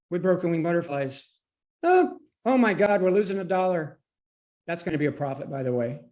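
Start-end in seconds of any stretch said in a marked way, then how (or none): 1.8-4.0 hammed-up panic, high and loud; mock alarm. Trader pretending dismay over losing a dollar, mock alarm. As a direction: gasp it out in pretend horror.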